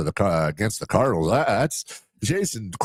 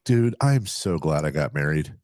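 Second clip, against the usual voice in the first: inner voice; 7 semitones lower than usual. Retold or imitated. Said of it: "your internal dialogue, you're like".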